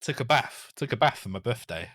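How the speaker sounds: bath said baf and birthday said bufday; northern baf. Shown as a demonstration. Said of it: "I'm from the north".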